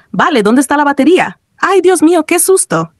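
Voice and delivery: high-pitched fright; startled exclamation. A narrator's reading. Gamer searching for an object, startled exclamation. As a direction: exclaim as if given a scare.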